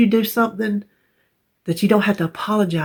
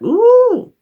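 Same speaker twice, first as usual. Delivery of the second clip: high, loud, drawn-out "ooh"; long show-off whoop of "ooh"; boastful ooh; 8 dB louder than usual. Real, not acted. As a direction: let out a big high "ooh" of self-admiration. Boasting about her letter writing.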